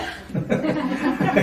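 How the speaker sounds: a quick high laugh at his own example; amused laugh. Real, not acted. Laughing at his own example to the listener. Laughs after naming himself.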